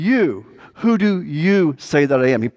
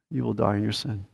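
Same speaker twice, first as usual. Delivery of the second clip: "You will die in your sin." repeated much softer, a grave hush; quiet echo; 3 semitones lower, 8 dB quieter, faster than usual. Acted. Preacher repeats the warning to listeners as a soft, grave echo.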